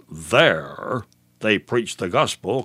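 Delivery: slow, weighty stress on "There". Shown as a demonstration. Preacher highlighting a word in scripture, stress on "There". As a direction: draw out "There", then give the rest evenly. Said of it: "So why the stress at this point?".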